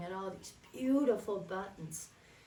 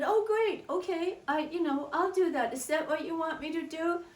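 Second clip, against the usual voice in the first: put-on much higher, louder thrilled child; excited little girl; 7 semitones higher, 4 dB louder, faster than usual. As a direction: chirp it like a delighted child. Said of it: "And I said".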